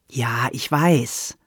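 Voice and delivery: slow, serious reply; earnest. Acted. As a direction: say it slowly, meaning it. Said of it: "antwortete Sandra ernsthaft".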